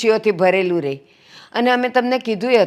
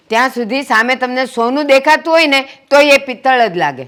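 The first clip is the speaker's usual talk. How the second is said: higher, insistent scolding; heated reproach; 4 semitones higher, 4 dB louder than usual. Acted. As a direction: plead their case with raised, emphatic voice. Woman rebukes angry guest in quarrel. (ઠપકાભર્યો, ભારપૂર્વકનો અવાજ)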